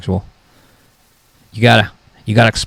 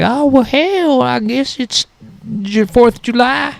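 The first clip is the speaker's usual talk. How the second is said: much higher, slow hammed-up yokel; the recipient's voice; 13 semitones higher, slower than usual. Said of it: "He's usually like".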